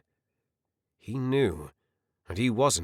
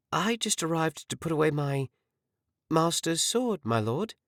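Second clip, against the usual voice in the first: higher, innocent little servant voice; pitched-up act of a girl with nothing to hide; feigned innocence; 7 semitones higher than usual. A narrator's reading. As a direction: play dumb, all innocence. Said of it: "she feigned innocence".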